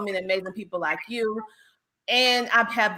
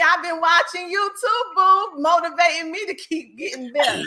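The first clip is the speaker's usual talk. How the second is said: normal talking voice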